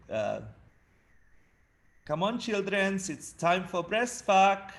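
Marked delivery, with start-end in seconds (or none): 2.0-4.7 imitating mum's Argentinian accent, higher, slow; a fond, hammed-up Argentinian mum announcing breakfast. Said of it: "in the morning she would say".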